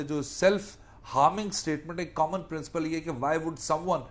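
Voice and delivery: ordinary talk, nothing marked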